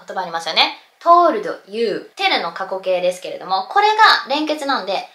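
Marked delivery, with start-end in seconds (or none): 1.0-2.1 "told you" as two words, "tooru-do yuu". Shown as a demonstration. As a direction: sound the d of told apart from you in "told you". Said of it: "なんて聞こえません". (「told you」をトールド・ユーと区切って発音)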